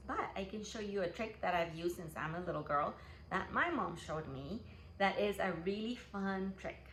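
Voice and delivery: ordinary talk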